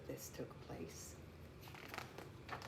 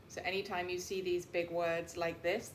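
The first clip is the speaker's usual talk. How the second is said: ordinary delivery, nothing marked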